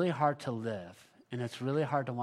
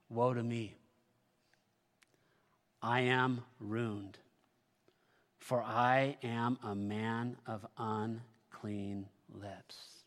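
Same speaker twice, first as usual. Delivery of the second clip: hushed lament; 2 semitones lower, slower than usual. Acted. Pastor voices Isaiah's ruin, slow and hushed. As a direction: sink into Isaiah's shame, very slowly.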